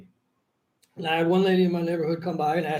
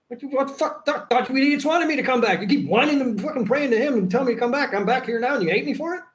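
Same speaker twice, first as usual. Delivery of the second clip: higher-pitched indignant complaint; mock exasperation; 6 semitones higher than usual. Returned saviour scolding those who prayed for him, exasperated. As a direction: protest in disbelief, pitched up.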